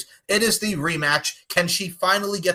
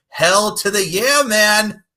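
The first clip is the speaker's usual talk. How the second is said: slow, loud, higher hype yell; hype shout; 7 semitones higher, 8 dB louder, much slower than usual. Real, not acted. Host hyping the Italian bout, slow loud yell. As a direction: stretch it out and shout it. Hype exclamation closing intro.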